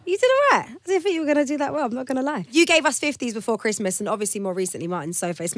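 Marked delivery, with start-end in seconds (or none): 0.1-1.7 high bright surprised praise; excited praise. Real, not acted.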